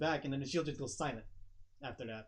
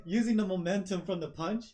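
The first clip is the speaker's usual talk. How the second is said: usual speaking voice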